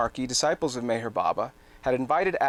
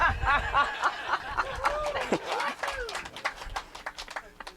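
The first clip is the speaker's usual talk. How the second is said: high, long laughter; 5 dB quieter than usual. Real, not acted. Laughing after introducing Agnes.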